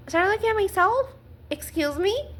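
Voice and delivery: offended, rising-pitched retort; indignant disbelief. Real, not acted. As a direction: throw the accusation back, affronted. Indignant retort to the blaming phrase.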